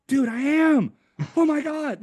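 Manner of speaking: high-pitched, awed retold exclamation; excited realization. Acts out his moved realization.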